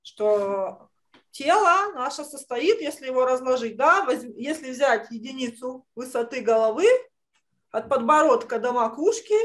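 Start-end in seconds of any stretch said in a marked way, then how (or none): none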